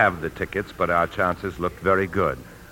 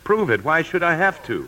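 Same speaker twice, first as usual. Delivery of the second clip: indignant outburst; 11 semitones higher, 5 dB louder than usual. Acted. Accused husband protests indignantly.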